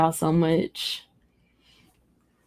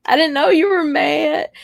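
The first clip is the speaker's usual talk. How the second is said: reassuring; 7 semitones higher than usual. Retold or imitated. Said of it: "I was like".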